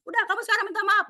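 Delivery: high, sharp demand; spontaneous scolding. Retold or imitated. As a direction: order the kids to apologise at once. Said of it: "dengan cara spontan memarahi anak". (memarahi anak secara spontan)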